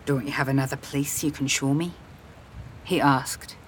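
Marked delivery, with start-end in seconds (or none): 0.0-1.9 low, soft male character voice; hushed, lightly voiced man; soft invitation. A narrator's reading. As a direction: ask quietly, coaxing a little. Man's soft invitation in story.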